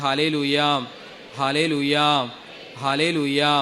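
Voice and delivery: loud Hallelujah shout. Shown as a demonstration. Preacher leading the congregation in a loud shout. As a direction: call it out loud for the crowd. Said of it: "സ്വരമുയർത്തി പറഞ്ഞേ".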